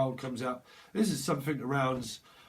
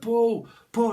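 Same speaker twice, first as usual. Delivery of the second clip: loud raised yell of pull pull; shouted pull; 8 semitones higher, 8 dB louder than usual. Retold or imitated. Reader voicing a brawler begging to be pulled back, loud yell.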